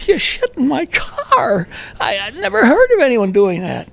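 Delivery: high, slow, whiny disbelief; stunned driver. Retold or imitated. Driver whining at the hitchhiker, high slow disbelief. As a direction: voice the driver as a wounded kid. Driver still quivering, quoted.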